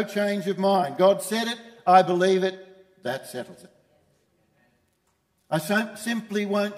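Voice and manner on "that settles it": whispered, much softer and lower; whispered conviction; whispered close. Acted. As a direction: breathe the last words. Closing the creed-like refrain.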